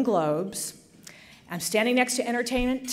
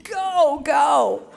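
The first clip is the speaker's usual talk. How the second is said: cheering on; 4 semitones higher, 4 dB louder than usual. Retold or imitated. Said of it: "kind of going".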